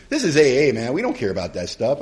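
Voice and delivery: ordinary delivery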